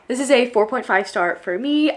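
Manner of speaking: ordinary talk, nothing marked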